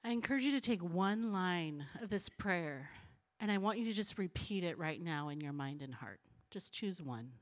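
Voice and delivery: low, soft voice leading quiet reflection; gentle, low invitation to pray; guided prayer. Real, not acted. Leads congregation in silent reflection and prayer.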